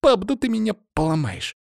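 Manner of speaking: put-on high, squeezed girl's complaint; daughter's protest. A narrator's reading. Daughter to father hugging her hard, protesting. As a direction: protest in a high voice from a tight hug. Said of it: "запротестовала девушка".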